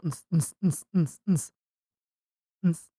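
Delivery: imitated club beat on "ns"; mouthed dance beat. Marking the end of the week, mouthed party beat. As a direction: pulse out a short, repeated techno beat.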